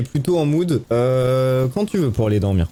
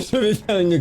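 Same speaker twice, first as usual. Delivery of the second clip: rire nerveux; 9 semitones higher than usual. Real, not acted. Rire nerveux, peur du jeu.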